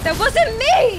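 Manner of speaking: high, loud protest; yelling. Real, not acted. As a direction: shout it out in disbelief. Streamer yelling at game death.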